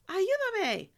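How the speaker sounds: high, loud cry for help. A narrator's reading. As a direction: call out urgently in Spanish. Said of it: "Sophia cried".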